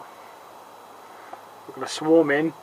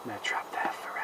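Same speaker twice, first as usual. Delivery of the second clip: whisper; 7 semitones lower, 16 dB quieter than usual. Acted. Whispered joke about trapped wasps.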